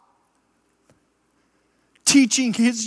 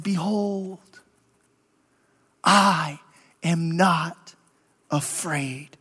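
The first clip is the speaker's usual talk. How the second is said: soft, low, calm and unafraid; 6 semitones lower, 7 dB quieter, much slower than usual. Acted. Young William facing death, a soft low calm reply. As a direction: say it quietly with no fear. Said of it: "in a calm voice".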